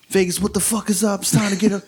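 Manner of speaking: high-pitched hype MC shout; scolding. Retold or imitated. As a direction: yell it like a club MC on the mic. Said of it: "I sound like I'm scolding them".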